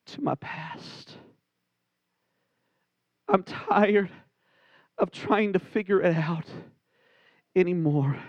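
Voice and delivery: low, hushed, weary prayer to God. Acted. Voices a worshipper's prayer.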